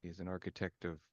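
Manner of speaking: low, very soft measured voice; confused formal character. Acted. Confused character answers a player about the architect, quiet and formal. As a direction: speak softly and low, choosing words with care.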